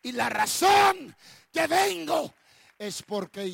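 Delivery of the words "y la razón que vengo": high, loud, slow preacher's call; preaching cry. Acted. Preacher voicing God's reason for coming, shouting. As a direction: lean into it and cry it out.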